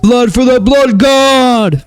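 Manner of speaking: slow, very high roaring battle cry; demonic war roar. Acted. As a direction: roar it like a berserker.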